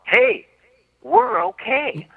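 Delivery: high, drawn-out stunned relief; giddy relief. Retold or imitated. To his wife after the hippo attack, giddy relieved realization. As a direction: let it float up in disbelief that you're alive.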